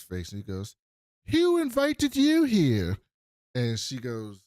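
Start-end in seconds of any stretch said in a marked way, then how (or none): 1.3-3.0 high, loud, slow accusing demand; hammed-up indignant yell, dragged out; mock angry confrontation. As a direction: play the drunk nephew turning on the guest. Confronting Jennifer at dinner table.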